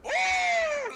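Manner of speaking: loud high whoop; triumphant whoop. Real, not acted. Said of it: "Let me get my maniacal laugh on".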